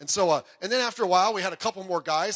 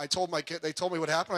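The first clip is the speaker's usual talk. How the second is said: normal talking voice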